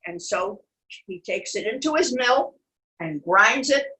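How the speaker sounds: lively storybook reading; animated picture-book narration; reading to children. A narrator's reading. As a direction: tell it to little ones.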